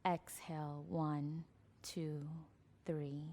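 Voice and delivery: slow, soft breathing count with long pauses; guided meditation. Acted. Leads counted belly breaths.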